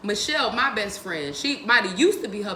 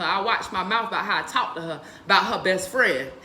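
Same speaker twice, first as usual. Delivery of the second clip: normal talking voice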